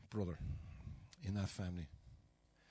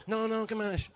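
imitating his mother; 9 semitones higher, 4 dB louder than usual. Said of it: "My ma's terrible".

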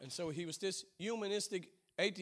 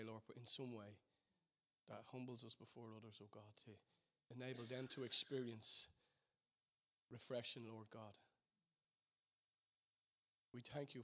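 earnest prayer; 3 semitones lower than usual. Acted. Pastor leading the church in closing prayer, earnest and fervent. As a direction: pray it out to the Father, humbly.

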